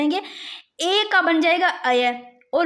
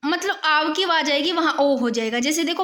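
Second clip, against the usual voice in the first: usual speaking voice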